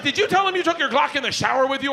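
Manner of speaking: high-pitched accusing screech; accusing wife. Retold or imitated. Wife exposing husband's lost Glock, accusing. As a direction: mimic a wife calling him out.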